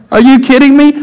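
put-on teen friend caught stealing, challenged in high disbelief. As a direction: throw up your hands in disbelief.

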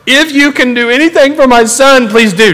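high, loud plea; pleading father. Acted. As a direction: beg urgently for your son, pitched well up. Desperate father pleading with Jesus.

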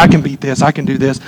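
quiet, low, half-hearted resolve; passive self-talk. Acted. Preacher acting out a passive believer facing temptation, quiet self-talk. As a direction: talk to yourself softly, sitting back. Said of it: "Usually we sit back".

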